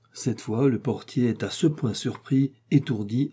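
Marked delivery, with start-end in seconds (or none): none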